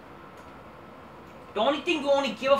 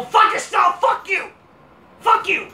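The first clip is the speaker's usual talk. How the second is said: raised, higher shouted curse; angry shout; 8 semitones higher, 5 dB louder than usual. Real, not acted. Vlogger yelling insults at the wrestler, loud and high. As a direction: yell it right at her.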